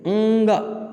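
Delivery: ंग alone, अ silent; only the nasal ंग. Shown as a demonstration. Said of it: "अ थोड़ा silent होगा". (ंग, बिना अ के)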